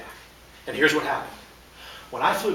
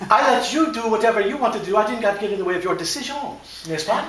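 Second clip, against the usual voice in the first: hammed-up French accent, pitched up and louder; a silly French accent, higher; fake French accent; 6 semitones higher, 5 dB louder than usual. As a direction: ham up the French to win the argument. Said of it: "kill the fake French accent".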